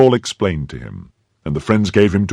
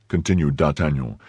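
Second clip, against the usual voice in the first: plain reading voice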